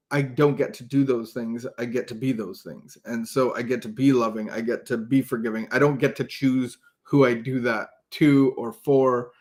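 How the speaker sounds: ordinary talk, nothing marked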